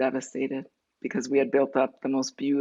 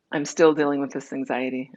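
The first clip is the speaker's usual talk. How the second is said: ordinary talk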